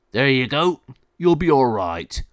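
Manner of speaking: loud, lightly acted reassurance; dog warden. Dog warden voiced in story.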